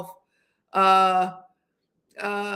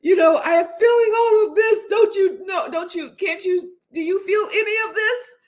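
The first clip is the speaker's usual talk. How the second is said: pitched much higher, pleading and teary; retold movie scene, imitating a crying plea; imitating a tearful plea; 9 semitones higher, 4 dB louder than usual. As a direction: play the heartbroken woman begging him to feel it. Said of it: "crying in a mess".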